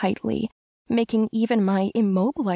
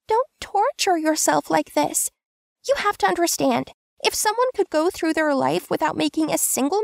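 high, pleading female voice; comforting woman; 10 semitones higher than usual. A narrator's reading. Tansu pleading with Kim not to torture himself. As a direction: reassure him urgently.

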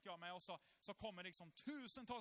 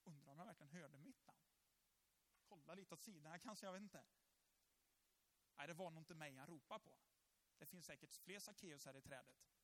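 much softer, lower, doubtful musing; unsure inner thoughts; 6 semitones lower, 8 dB quieter than usual. Retold or imitated. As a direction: mutter to yourself, hardly daring to believe. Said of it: "jag tror Sackeus tänker här".

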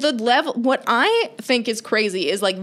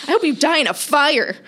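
higher-pitched mock venom; aggressive commenter; 6 semitones higher, 4 dB louder than usual. Host imitating online haters, mock aggressive. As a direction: play an online hater on the attack. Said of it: "so aggressive what people will say".

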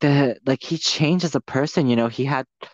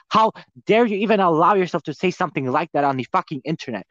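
ordinary talk, nothing marked

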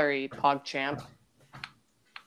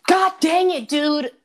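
higher, louder exasperated yell; frustrated shout; 11 semitones higher, 7 dB louder than usual. Real, not acted. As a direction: blurt it out in real frustration. Just got killed in game.

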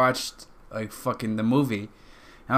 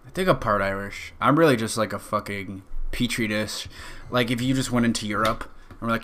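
normal talking voice

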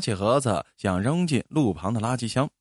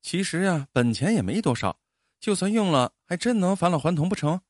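higher voice, talking herself round; self-consoling wife; 6 semitones higher than usual. A narrator's reading. Wife playing it down for husband, higher consoling voice. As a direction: make light of it as the wife. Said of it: "老婆就自我安慰地笑了".

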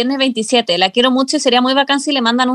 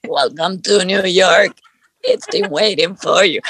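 low, slow smoker's voice singing; smoker's rasp; 5 semitones lower, slower than usual. Pretend. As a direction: sing it like a chain smoker. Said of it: "canta algo con la voz de fumadora".

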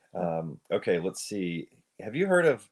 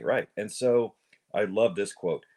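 normal talking voice

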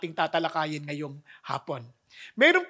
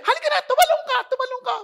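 father urging; 5 dB louder, faster than usual. Retold or imitated. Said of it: "sabi niya".

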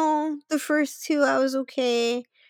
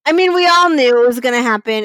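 ordinary delivery

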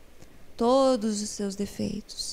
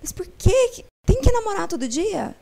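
high, incredulous mimicked question; imitated puzzled questioners; 9 semitones higher, 3 dB louder than usual. People questioning the counsellor about daily romance, imitated high-pitched disbelief. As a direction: pitch it up in mock astonishment. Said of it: "as pessoas perguntam assim pra mim".

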